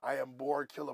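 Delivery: lightly put-on solemn naming voice. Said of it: "It wasn't nothing like that".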